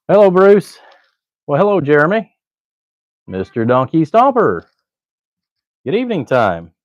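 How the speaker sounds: high, loud sing-song hello; playful greeting. Acted. Greeting viewers in the chat.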